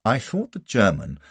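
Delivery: ordinary delivery, nothing marked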